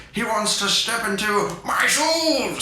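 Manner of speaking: slow mock fury; mock outrage. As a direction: drag it out in scandalised disbelief. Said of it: "And he goes".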